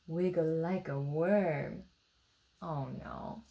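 plain reading voice

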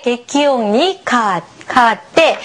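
ordinary delivery

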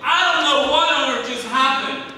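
loud, high, astonished friend; bursting in with impossible news, loud and high. Retold or imitated. Second friend at the door shouting that Jim is alive, loud and high. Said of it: "he says with a loud voice".

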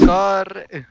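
loud, high urgent yell; urgent shout. Real, not acted. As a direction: shout the warning. Urgent game call.

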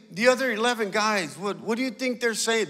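ordinary talk, nothing marked

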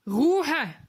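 loud, growled call for silence; growled order. A narrator's reading. Sergeant silencing the noisy boys, growling. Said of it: "knurrte der Wachtmeister".

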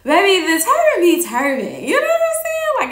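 loud, high-pitched hype; playful boasting. Real, not acted. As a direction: crow it proudly, voice leaping way up. Playful boast about turbans.

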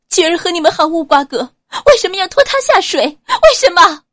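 high, loud, fast accusing shout building to a cry; sharp furious outcry. A narrator's reading. Ouyang Nuan accusing the Xiao family, fast furious high shout. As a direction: hurl every question at them, rising to a scream. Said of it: "他厉声道".

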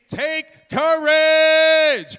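very loud, high, drawn-out cry; huge rallying call; booming shout. Acted. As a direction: bellow it out, stretching the last word. Shouts Jesus's call to disciples.

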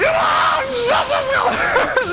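very high, loud, slow hype shriek; stretched out hype yell; excited scream. Real, not acted. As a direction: scream with delight at the fight. Excited scream as the fight starts.

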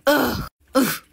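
loud exasperated ugh; frustrated groan. Acted. Lost girl groaning loudly at confusing directions.

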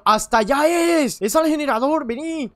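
calling out to a lagging teammate. Real, not acted. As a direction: call across the map, high and loud. Calling friend toward the generator.

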